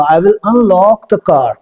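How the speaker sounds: slow, careful model sentence; slow spoken drill. Shown as a demonstration. Teacher models the car sentence slowly for the class. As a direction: say it slowly so learners can follow. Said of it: "इनको पहले बोल के देख लेते हैं".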